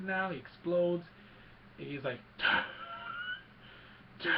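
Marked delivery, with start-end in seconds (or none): 2.4-4.3 imitated wheezy gasps with long tails; mock laboured breathing; asthmatic breathing. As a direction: breathe like a struggling masked villain. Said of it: "even more asthmatic".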